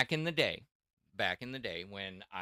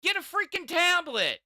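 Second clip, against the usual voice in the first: rising, louder exasperation; who even needs this, getting louder; exasperated rant; 14 semitones higher, 5 dB louder than usual. Real, not acted. Ranting about triple fold phones.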